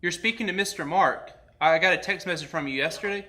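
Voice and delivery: usual speaking voice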